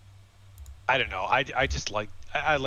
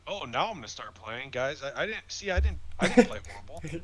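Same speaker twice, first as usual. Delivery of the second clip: higher-pitched, exaggerated mocking voice; mock quarterback quote; 6 semitones higher, 6 dB louder than usual. Podcast host imitating a quarterback after a comeback, mock self-defense. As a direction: imitate him, pitch climbing and getting louder. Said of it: "he's just like".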